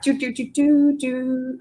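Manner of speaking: mock rewind jingle to turn back the clock; rewind noise. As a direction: hum a playful rewind effect. Rewinding time after "wind back.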